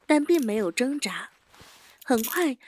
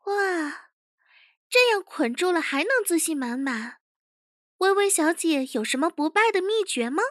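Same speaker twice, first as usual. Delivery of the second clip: higher-pitched, mock-curious questioning; higher young chief's voice, taunting; teasing interrogation chief; 6 semitones higher than usual. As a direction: needle the captive with feigned admiration.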